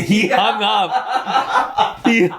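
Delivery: cracking up while telling it; laughing. Real, not acted. Telling friends the punchline, laughing. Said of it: "That long pause of".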